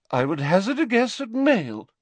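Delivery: put-on an eccentric old prophetess recounting; dreamy seer. A narrator's reading. Trelawney voice.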